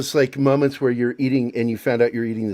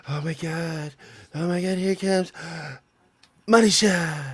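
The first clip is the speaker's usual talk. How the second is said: mock ecstasy; 6 semitones higher, 3 dB quieter than usual. Host reveals work on shared screen, hammed-up gasping awe. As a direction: play up breathless awe, pitched high.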